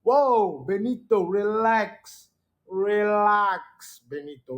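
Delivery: mock loud whoa, then a slowed, soothing relax; a friend's hammed-up calm down, as if settling a hothead; Pastor D calming. Pastor D hushes an upset Benito, a lightly mocking calm-down. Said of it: "And Pastor D was like".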